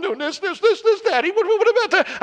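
put-on high, whiny little boy pleading with Daddy; five-year-old. To his mother, a whiny little-boy plea. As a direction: plead like a small child. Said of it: "I sound like a five-year-old".

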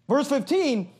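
ordinary delivery

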